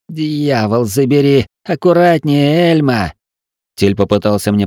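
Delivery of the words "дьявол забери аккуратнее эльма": pained outcry. Acted. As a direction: yelp as the stitch hurts. Patient cries out in pain.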